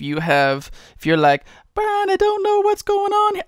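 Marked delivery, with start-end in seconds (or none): 1.7-3.4 high, loud Southern falsetto; mock Southern accent. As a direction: complain in a squeaky Southern accent. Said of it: "such a southern accent".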